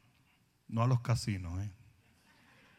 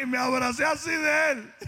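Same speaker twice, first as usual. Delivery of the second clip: excited retelling; 12 semitones higher, 3 dB louder than usual. Retold or imitated. Retelling the panic, still excited.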